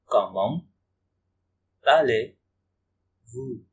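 Comment, pause, then allez-vous stretched very slowly; very slow, deliberate classroom pronunciation; slow teaching demo. Shown as a demonstration. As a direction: break it apart and drawl allez-vous for learners. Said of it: "teacher repeats phrase slowly".

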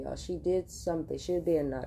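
normal talking voice

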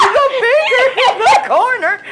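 mock store announcer over the PA; manager on speaker. Retelling the store manager's overhead announcement, mock announcer voice. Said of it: "the manager is like".

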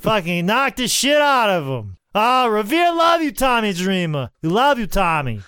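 rowdy Revere fan cheering Tommy Dreamer; Boston townie accent. Pretend. Host plays Revere fan cheering Tommy, shouting Boston accent. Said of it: "why don't you watch The Town".

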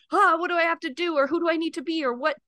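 high, hurried string of anxious questions; hammed-up panic, pitched high and rushed; mock fretting. Voicing her old need to control everything, mock fretting.

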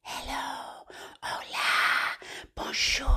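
whispered greetings, drawn out; as soft as possible. Shown as a demonstration. Greeting babies as softly as we can, whispering. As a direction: whisper each hello gently. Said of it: "as softly as we can".

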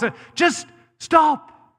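high, slow shout of stop; preacher's shout. Real, not acted. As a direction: cry it out to the church, slow. Preacher calling out to congregation.

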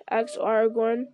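ordinary delivery, nothing marked

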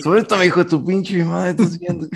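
high angry mock outburst. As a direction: hurl it like a furious man. Acting out the angry man.

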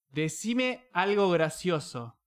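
slow, spelled-out request to a phone; slow clear command. Real, not acted. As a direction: speak slowly so the phone understands. Dictando una orden al celular. (dictado lento al celular)

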